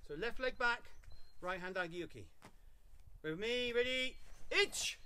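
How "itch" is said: high, loud count call; drill count. Real, not acted. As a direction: call the count sharply to the class.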